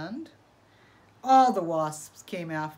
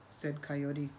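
ordinary delivery

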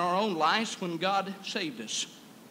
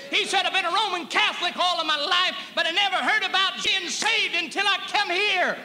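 preaching at full force; 10 semitones higher, 6 dB louder than usual. Real, not acted. Preacher shouting joy over grace to the congregation.

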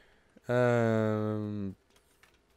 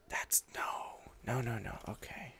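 mock sad whisper; 9 dB quieter, slower than usual. End of episode announced.

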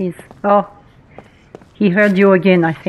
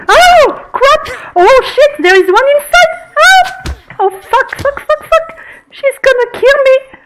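panicked high-pitched yelling; screaming in real panic; yelling across the room; 5 dB louder, slower than usual. Real, not acted. Attacked by monster in game.

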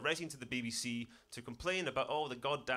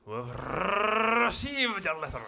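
hugely rolled Scottish R, higher and louder; thick Scottish accent; 7 semitones higher, 7 dB louder than usual. Acted. Courtesy call to a complainer, outrageously broad Scottish. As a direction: trill the R in exaggerated Scots.

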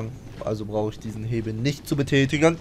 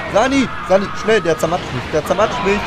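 urgent high shouting for help; panicked call for medic; 7 semitones higher, 5 dB louder, faster than usual. Real, not acted. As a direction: yell for the medic while getting crushed. Calling medic under attack. (panischer Ruf nach dem Sanitäter)

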